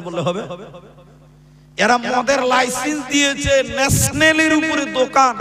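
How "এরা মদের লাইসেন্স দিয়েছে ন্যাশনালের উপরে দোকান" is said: loud, high preacher's denunciation; raised preaching. Real, not acted. Preacher to the crowd about liquor licences, raised preaching. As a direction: preach it out, pitched up and slow.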